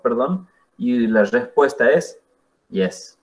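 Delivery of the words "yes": YES low and level, unenthusiastic; neutral tone. Shown as a demonstration. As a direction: say YES with no rise or fall, half-hearted. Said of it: "el neutral, el que, como sin ganas". (YES en tono neutro, sin ganas)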